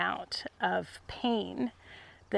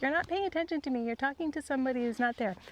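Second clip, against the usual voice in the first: much higher, fast, playful horse voice; voicing the horse Skaha; 4 semitones higher, much faster than usual. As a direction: speak for the pushy horse, quick and high. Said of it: "Skaha is like".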